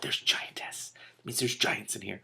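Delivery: hushed whisper. Real, not acted. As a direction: whisper it like a secret. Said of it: "In the story, I mean".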